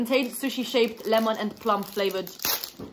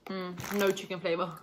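chewing while talking; nibbling a crisp; mouth full of crisps; 3 semitones lower, 7 dB quieter than usual. Real, not acted. Seen 1: Speaker unclear; left girl nibbles a crisp, the right seems to chew.

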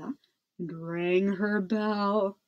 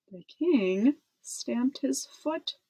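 ordinary delivery

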